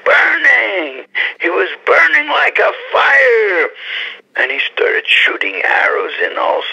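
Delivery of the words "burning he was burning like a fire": high, crying-out preacher's fervor; impassioned shout, pitched way up; fervent cry. Real, not acted. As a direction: cry it out like a burning fire. Fiery praise of holy man.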